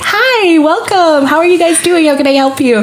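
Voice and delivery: high, chirpy shop greeting; bright eager welcome to shoppers; customer service voice. Retold or imitated. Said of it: "There's nothing better than the customer service voice".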